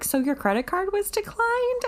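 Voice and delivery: high put-on clerk voice, asking; imitated store clerk. Telling a friend about the store's call, high mock clerk voice. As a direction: mimic the puzzled clerk on the phone. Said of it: "the store called us and was like".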